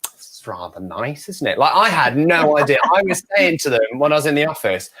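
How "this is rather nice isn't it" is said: posh understatement. Retold or imitated. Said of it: "I was like".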